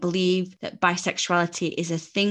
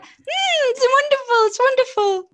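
much higher, louder, forced gush; overly bright reassurance; high-pitched mum; 7 dB louder than usual. Retold or imitated. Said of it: "her pitch was a lot higher".

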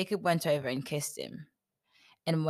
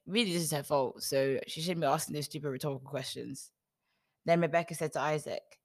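plain reading voice

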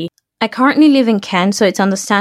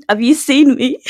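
laughing; 5 semitones higher than usual. Real, not acted. Laughing at bad matches.

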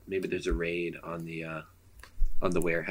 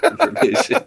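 normal talking voice

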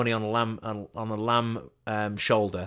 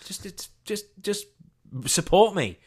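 strained plea; 10 semitones higher, 3 dB louder, slower than usual. Retold or imitated.

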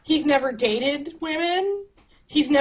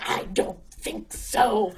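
slow, dry brush-off; lightly voiced smug rival; dismissive retort; 4 semitones lower, 4 dB quieter, slower than usual. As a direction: shut her down coolly.

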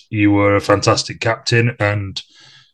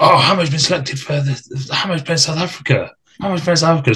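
higher, excited chant of the news; excited teammates; 6 semitones higher than usual. Retold or imitated. Teammate telling the squad, excited higher chant. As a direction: mimic the lads hyping it up. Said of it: "everyone was like".

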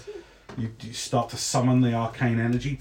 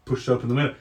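ordinary talk, nothing marked

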